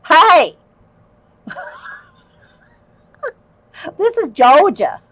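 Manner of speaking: high, laughing hello to a viewer; giggling while greeting a friend in chat. Real, not acted. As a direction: laugh through the words.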